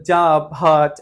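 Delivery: job and hot with open "aa" vowels; firm, separate job, hot, as "jaab, haat"; model pronunciation. Shown as a demonstration. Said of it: "এরকম বলতে হবে".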